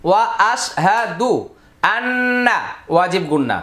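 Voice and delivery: ordinary talk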